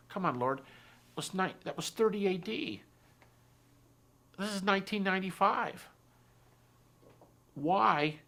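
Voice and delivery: high-pitched, pleading argument with God; exasperated protest. Retold or imitated. Acting out his protest to God.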